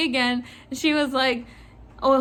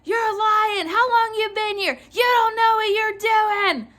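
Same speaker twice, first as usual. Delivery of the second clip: imitated losing it; 9 semitones higher than usual. Clerk mimics customer accusing her of lying, losing it. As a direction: rail like an outraged customer, pitched way up. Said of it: "She fucking loses it".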